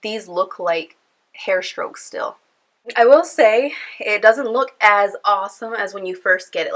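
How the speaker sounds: ordinary talk, nothing marked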